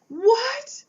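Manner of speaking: very high, loud shocked cry; shocked what. Retold or imitated. Volunteer hears the cat was found and cries out in a very high, loud voice. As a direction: shriek the word in disbelief. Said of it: "She was like".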